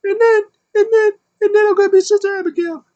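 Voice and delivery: very high, loud squeaky falsetto; mock female voice, squealing threats; Alexa Bliss. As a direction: rant in a hammed-up shrill falsetto, vowing revenge. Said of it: "And Alexa Bliss is like".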